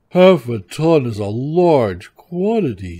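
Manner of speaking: big bear's loud complaint; sighing bear. A narrator's reading. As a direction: lament heavily as a big bear. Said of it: "sighed the bear".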